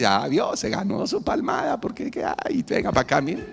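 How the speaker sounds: playful fatherly scolding, half laughing; a hint of a fond father correcting a child; the Lord scolding fondly. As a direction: tease a child you are about to hug. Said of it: "Y el Señor nos ha dicho".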